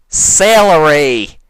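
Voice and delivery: loud, slow, high shout of Celery; exclaiming. Acted. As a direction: belt the word out like a prize. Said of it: "You exclaim".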